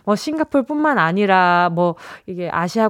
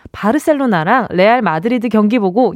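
ordinary talk

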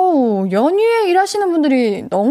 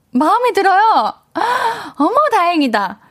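delighted surprise; 7 semitones higher than usual. Real, not acted. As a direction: gasp with happy disbelief. Host reacts to mother's news. (깜짝 놀라 반가워하며)

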